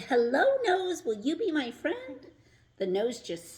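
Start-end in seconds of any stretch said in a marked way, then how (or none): 0.0-2.3 high, loud eager call; shouted. A narrator's reading. Rock shouting to a shiny nose, high loud call. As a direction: shout up hopefully. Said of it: "when he shouted".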